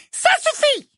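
very high, sharp cut-off; cutting Umbridge. A narrator's reading. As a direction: shut her down. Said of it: "coupa le professeur Ombrage". (voix tranchante et aiguë)

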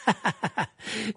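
high sneering chuckle; mocking laugh. A narrator's reading. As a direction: laugh him off. Karkaroff ricane. (rire moqueur de Karkaroff)

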